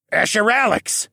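high, loud character call; Tovish. A narrator's reading. Tovish calling from someone's back, loud and high. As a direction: call out to get attention. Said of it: "Tovish said".

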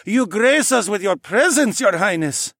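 high, loud, deferential male figure; fawning councilman. Acted. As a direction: greet royalty with effusive deference. Councilman bows to royal visitors.

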